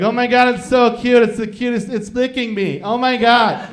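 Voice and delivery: put-on high, loud gushing baby talk; gushing city couple. Rich couple cooing over the grandkids, high and gushing. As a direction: squeal over the baby like a fancy city lady.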